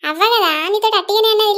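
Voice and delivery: usual speaking voice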